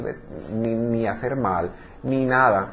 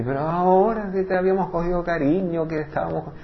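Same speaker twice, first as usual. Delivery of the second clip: higher, louder plea not to leave; raised, imploring voice of the family; pleading family; 5 semitones higher, 4 dB louder than usual. Acted. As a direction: beg her to stay. Family begs nanny to stay.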